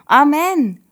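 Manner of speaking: joyful loud high amen; shouted amen. A narrator's reading. Children cheering amen after the prayer. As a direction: call out amen all together. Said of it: "rufen die anderen".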